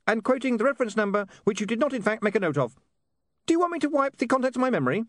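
high, fast rattled-off legal patter; tiny imp reeling off small print; giggly sneering imp. Acted. Imp reciting warranty rapidly.

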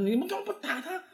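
a squeaky dad asking why not fight back; imitating dad. Dad asking why they didn't hit back, high mock voice. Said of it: "然后我爸说".

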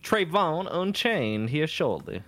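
slow, hammed-up Southern drawl; a comic Southern minstrel accent, drawn out; mock Southern plantation accent. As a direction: lay on the old Southern plantation accent for laughs. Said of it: "plantation owner accent as a slave".

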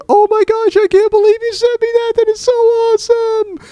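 very high, gushing squeal of delight; squealing excitement. Retold or imitated. Host imitating a friend squealing over a gift box. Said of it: "just going".

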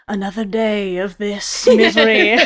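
hammed-up dark, poetic misery; mock tortured poet. Comic reading from old journals about a dark time, mock tortured. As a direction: read it like an overwrought journal entry. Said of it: "dark, poetic place at that time".

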